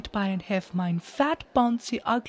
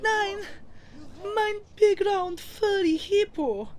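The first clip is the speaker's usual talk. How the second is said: grief-stricken shriek; 10 semitones higher than usual. Acted. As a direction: wail then order the guards. Queen sees husband killed.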